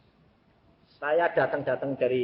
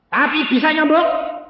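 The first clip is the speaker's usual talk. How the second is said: high, loud retold question; loud challenge; 14 semitones higher, 12 dB louder than usual. Asking if it can heal, loud challenge. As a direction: demand an answer, raised voice.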